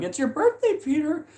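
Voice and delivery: very high-pitched put-on voice; high, mock sing-out to a relative; imitated birthday reminder. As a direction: pitch it way up, mimicking a movie character. Voices relative announcing his birthday.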